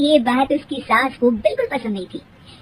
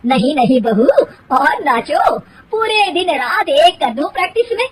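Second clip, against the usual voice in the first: louder mocking mother-in-law; sarcastic taunt; 3 semitones higher, 4 dB louder than usual. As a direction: sneer at her sweetly with sarcasm.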